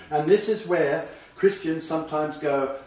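ordinary delivery

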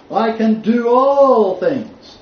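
I can do all things drawn out high and loud; loud, slow, triumphant verse; slow proclamation. Shown as a demonstration. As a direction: declaim each word slowly. Proclaiming the pivotal text.

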